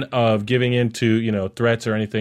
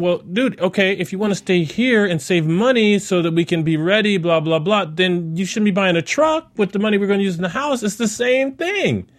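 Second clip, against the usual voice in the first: higher-pitched voice of a questioning wife; retold exasperation; 7 semitones higher than usual. Voicing wife questioning the truck purchase.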